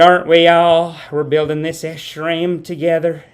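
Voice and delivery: rally the crowd in a put-on Southern drawl; mock Southern rallying. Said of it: "I said".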